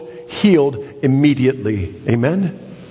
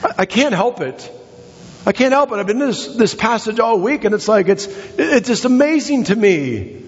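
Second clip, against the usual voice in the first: higher pitched, eager; can't hold back the enthusiasm; real excitement spilling over; excited; 9 semitones higher than usual. Real, not acted. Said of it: "I'm getting all excited".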